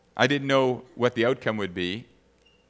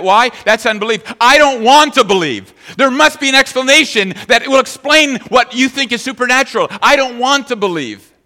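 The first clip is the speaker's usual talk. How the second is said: loud, higher preaching voice; forceful preaching; 10 semitones higher, 9 dB louder than usual. Acted. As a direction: drive the point home to the congregation. Preaching builds to full force.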